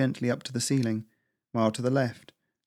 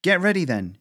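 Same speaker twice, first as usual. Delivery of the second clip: raised, louder call; brisk shout, pitched up; shouted back; 8 semitones higher, 5 dB louder than usual. A narrator's reading. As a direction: call it back over your shoulder. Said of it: "she shouted back".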